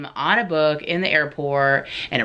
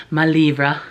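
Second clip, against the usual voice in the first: mock French; 4 dB louder than usual. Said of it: "That's all the French are gonna get from me".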